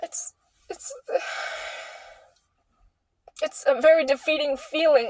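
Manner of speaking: crying. Real, not acted. Tearful pause recounting despair.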